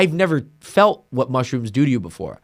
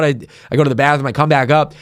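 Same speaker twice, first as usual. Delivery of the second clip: usual speaking voice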